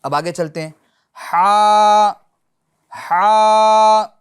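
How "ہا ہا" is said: ہا pushed from the middle of the throat, loud and held; loud throaty haa. Shown as a demonstration. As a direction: breathe ہا out hard from mid-throat. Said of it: "اس کو درمیان حلق سے ادا کرنا ہے". (ہا کو درمیان حلق سے زور سے ادا کریں)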